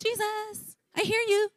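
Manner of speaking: soft, high, tentative little call; half-hearted mock searching call, kept small; timid calling. Preacher mocks a half-hearted seeker calling Jesus, timid calling. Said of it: "Not, "Let's see what happens".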